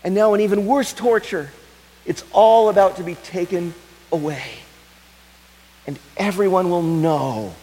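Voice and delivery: high, loud preaching build; impassioned preaching. Acted. Preacher telling the congregation of her torture, impassioned. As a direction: drive it home to the congregation.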